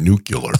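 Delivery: nuclear said as NOO-kyuh-ler; Bush's mispronunciation. Retold or imitated. Podcaster recalls eight years of Bush, nuclear as "nucular". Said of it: "eight years of Bush saying nuclear".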